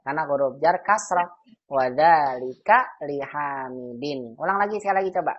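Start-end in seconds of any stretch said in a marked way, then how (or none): 1.7-4.4 each word drawn out very slowly, final din clear; slow model reading. Shown as a demonstration. Teacher modelling the Arabic line slowly for Bu Wiwik. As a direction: spell out the phrase slowly for a learner. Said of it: "jangan lupa Ibu".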